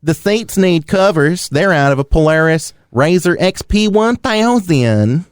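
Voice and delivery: put-on reading the ad like a Tennessee good old boy; Tennessee accent. Podcast host reads a car ad to his cohost in a mild Tennessee accent. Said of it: "let me finish the rest of this ad in a Tennessee accent".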